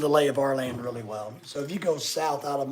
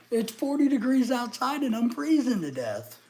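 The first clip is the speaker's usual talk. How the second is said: pitched up and loud, fed up with the cold; loud higher exasperation; 9 semitones higher, 5 dB louder than usual. Real, not acted. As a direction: complain about the cold, raised and loud. Complaining about freezing.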